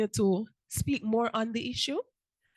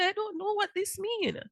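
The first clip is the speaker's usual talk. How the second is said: confused; 12 semitones higher, 4 dB quieter than usual. Retold or imitated. Repeated confused quote.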